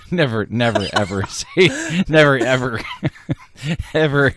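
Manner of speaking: loud, laughing never ever; laughing emphatic never. Real, not acted. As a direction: repeat never through laughter, building loud. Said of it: "I'd never say that again".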